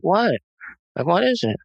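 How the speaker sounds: high-pitched, dazed retold questions; subdued, sad. Wife stopped mid-vacuuming to husband, subdued and sad. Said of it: "subdued, it was just, like, so sad".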